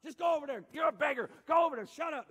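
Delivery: loud, high scolding shouts; crowd shushing a beggar. Acted. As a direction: shout him down dismissively. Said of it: "everybody told him".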